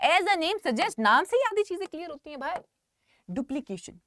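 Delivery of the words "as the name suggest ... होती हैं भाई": animated. Real, not acted. Teacher exclaiming to class.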